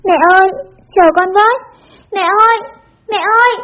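high-pitched call after someone leaving; narrated child's cry, pitched well up but not truly yelled; crying out loudly. A narrator's reading. Child running after departing mother, calling out. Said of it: "vừa gào to".

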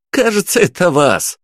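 put-on high, playful teen; teasing teen voice. A narrator's reading. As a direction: tease like a cheeky kid. Teen teases the narrator.

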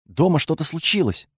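ordinary delivery

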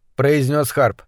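ordinary delivery, nothing marked

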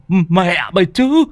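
high, shrieking, cursing lowlife voice; angry cursing. Acted. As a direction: curse him out, wounded and outraged. Lame man cursing after being shoved.

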